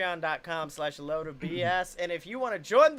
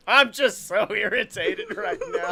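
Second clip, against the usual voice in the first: louder, through laughter; laughing irritation; 5 dB louder than usual. Real, not acted. Host caught on a word by co-host, laughing irritation. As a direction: say it while cracking up. Cracking up while claiming irritation.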